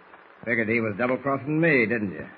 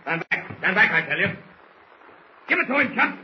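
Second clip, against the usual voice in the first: rising frantic shout, much higher; panicked shouting; 9 semitones higher, 4 dB louder than usual. Acted. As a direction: yell orders in a panic. Bull panics and orders attack.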